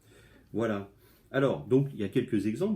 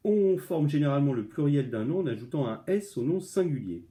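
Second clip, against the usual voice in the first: higher, louder textbook reading; reading the rule aloud; 5 semitones higher, 6 dB louder than usual. Shown as a demonstration. Teacher reads the plural rule to pupils, reading voice. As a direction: read the plural rule straight from the book.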